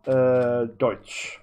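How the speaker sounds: slow, hammed-up broken German; fake German. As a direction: drawl out broken schoolbook German. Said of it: "That's what I would say about that".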